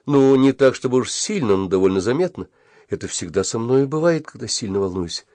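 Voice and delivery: plain reading voice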